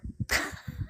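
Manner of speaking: soft, high breath of laughter; light voiceless chuckle; real laughter. Real, not acted. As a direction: laugh quietly to yourself. Laughs after a fond remark.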